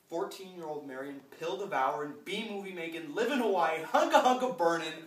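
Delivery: rising in pitch and volume to a shouted climax; building rant. Acted. Comedian listing a rock star's sins, building to a shout. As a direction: pile up the list, ending in a high, loud holler.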